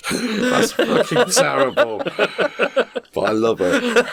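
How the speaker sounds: high laughing through the words, slowed; groan-laugh at a bad pun; real helpless laughter. Real, not acted.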